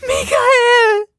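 very high ghostly shriek. Acted. The dead wife's voice shrieks through the door. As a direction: scream his name from beyond the door. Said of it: "kreischte Michels Stimme".

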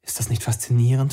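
ordinary delivery, nothing marked

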